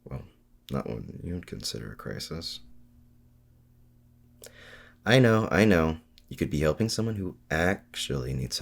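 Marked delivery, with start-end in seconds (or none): 0.0-2.6 low and much softer, trailing off; lower, softer, rising on "you'd"; sheepish admission. Acted. Quiet confession after denial. 5.0-6.0 exasperated. Acted. Cutting off an expected lecture.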